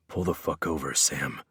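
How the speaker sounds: low, quiet growl; restrained, menacing low order. A narrator's reading. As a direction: growl the command under your breath. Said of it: "I growled".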